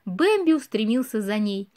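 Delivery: usual speaking voice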